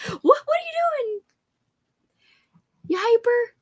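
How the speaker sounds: cutesy doggy talk; talking to a pet. Real, not acted. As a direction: ask the dog in a squeaky little voice. Talking to her dog.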